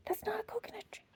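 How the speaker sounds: unvoiced whisper, NOT stressed; whispered warning. Hippo whispering to the children, unvoiced. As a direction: breathe the words, no voice.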